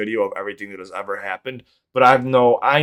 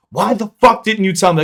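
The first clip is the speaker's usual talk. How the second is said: loud, high-pitched fiery outburst, imitated; fiery, not angry; 11 semitones higher, 9 dB louder than usual. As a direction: imitate a fiery girlfriend's raised-voice demand. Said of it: "Like, not angry, but ... a little fiery".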